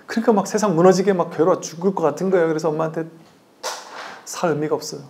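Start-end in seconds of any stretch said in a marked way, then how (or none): 4.2-5.0 mock despair. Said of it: "괴롭다고 한단 말이에요".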